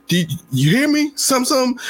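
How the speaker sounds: high, annoyed teacher voice; a teacher losing patience; mock irritated teacher. Teacher in a bad mood to kid, mock irritated. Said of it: "he says".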